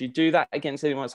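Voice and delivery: normal talking voice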